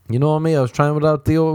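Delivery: ordinary delivery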